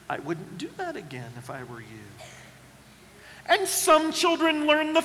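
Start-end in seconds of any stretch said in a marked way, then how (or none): 0.1-2.1 much softer, lower knowing warning; understated parental caution. Retold or imitated. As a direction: warn calmly, I-told-you-so. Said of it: "And you say".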